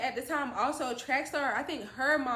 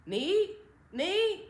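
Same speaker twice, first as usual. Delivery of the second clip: high worried mom call; imitating mom's anxious call; 8 semitones higher than usual. Mom calling daughter through door.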